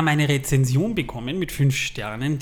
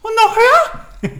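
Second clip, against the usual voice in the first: highest squeal, louder still; even higher; 10 dB louder than usual. Shown as a demonstration. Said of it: "Und die wurde immer höher".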